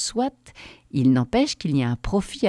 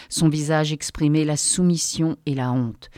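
usual speaking voice

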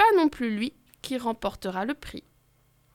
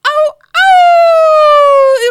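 very high, very loud howl; crowd howl; 15 dB louder than usual. Acted. Crowd of wolves howling in delight. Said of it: "hurle la foule en délire".

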